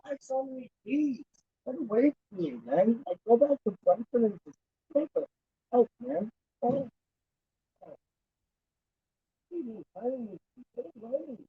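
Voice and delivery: high-pitched, faint distant complaint; calling from afar. Real, not acted. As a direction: fend off bees far from the mic. Fleeing bees away from mic.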